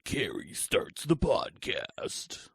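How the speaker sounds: movie trailer voice. Acted. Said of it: "my movie trailer voice".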